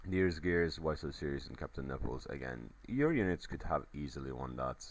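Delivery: normal talking voice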